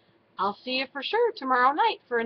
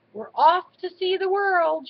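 louder, higher storybook exclamation; excited cry; 7 semitones higher, 6 dB louder than usual. A narrator's reading. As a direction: cry it out like setting off on adventure. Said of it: "she cried".

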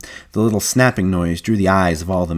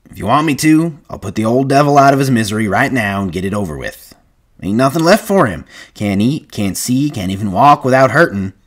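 louder, higher, pushy drawn from conviction; insistent ranch hand; 5 semitones higher, 5 dB louder than usual. Acted. Carlson urging Candy to put the old dog down, insistent. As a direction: press the point hard, like a blunt ranch hand.

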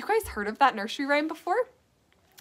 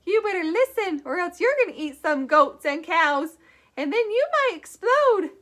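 higher put-on old lady voice; old lady puppet; 5 semitones higher than usual. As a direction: voice the puppet scolding kindly. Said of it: "Did you guys like my old lady".

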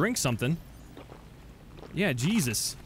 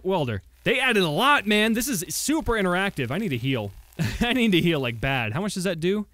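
normal talking voice

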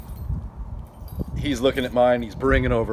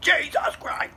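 mock scream in a strained high voice, held back in volume; going ballistic; 3 dB quieter, slower than usual. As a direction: imitate an outraged man, drawn out and squeezed high. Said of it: "he goes ballistic".

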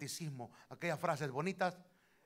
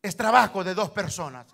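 much louder with a laugh in it; loud laughing exclamation; 3 semitones higher, 15 dB louder than usual. Real, not acted. Preacher loudly telling the brethren marriage takes two. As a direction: shout it out, half laughing.

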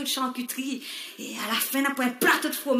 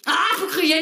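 loud, higher cry of anger; mock shout; 6 semitones higher, 8 dB louder than usual. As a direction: shout it like an angry Creole. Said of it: "Un créole, quand il est en colère, il crie". (cri de colère moqueur)

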